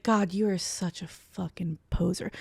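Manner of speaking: slow, whispery put-down; scornful aside. Retold or imitated. Woman to exposed fake gangster, hushed slow scorn. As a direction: drop to a soft, slow hiss of contempt. Scorn as cops find nothing.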